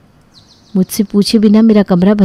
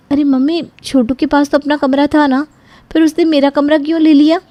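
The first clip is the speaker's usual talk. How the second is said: higher, indignant daughter; hurt disbelief in the question; surprised protest; 8 semitones higher than usual. Acted. Daughter protesting to her mother about her room, higher and indignant.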